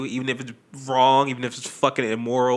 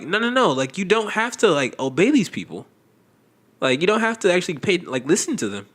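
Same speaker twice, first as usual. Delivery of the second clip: emphatic; 6 semitones higher than usual. Retold or imitated. Retold inner voice, raised pitch.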